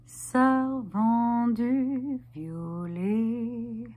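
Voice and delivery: very slow, stretched, keening words; drawn-out lament. Acted. Performer lamenting a sold and violated sister, drawn out.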